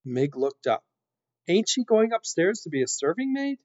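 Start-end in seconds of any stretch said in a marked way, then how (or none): 1.5-3.6 put-on high-pitched simple serving girl; questioning serving girl. A narrator's reading. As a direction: ask it high, as a puzzled girl. Mig questions the rat.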